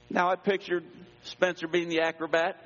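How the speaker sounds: ordinary delivery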